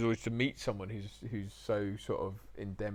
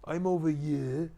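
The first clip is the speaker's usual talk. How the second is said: loud, high, drawn-out Welsh order; Mark Price; 6 semitones higher, 6 dB louder, slower than usual. Retold or imitated. Director placing his actor on set, bossy Welsh accent. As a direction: warn them off in broad, slow Welsh. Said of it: "He said".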